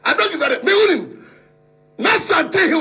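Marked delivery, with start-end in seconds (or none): none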